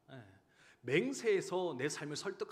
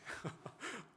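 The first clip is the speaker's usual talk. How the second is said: breathy laugh; 10 semitones higher, 6 dB quieter than usual. Real, not acted. Moved by son's words.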